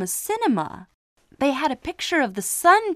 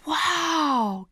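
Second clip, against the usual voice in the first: gasped; 7 semitones higher than usual. Acted. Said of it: "gasped Simon".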